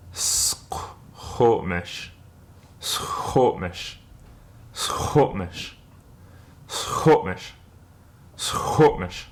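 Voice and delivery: "Squamish" said as a slow "Skotmish"; patient repetition for learners; slow modelling. Shown as a demonstration. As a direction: repeat "Squamish" slowly with the catch before "mish". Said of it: "let's slowly put it all together".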